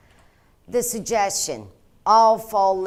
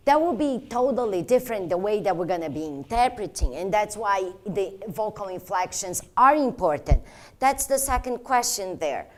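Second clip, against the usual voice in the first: normal talking voice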